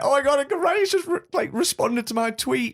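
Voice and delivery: very high, gushing fangirl imitation; fangirly squeal. Fan retelling Edgar Wright's reply, mock fangirly. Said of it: "proper fangirly".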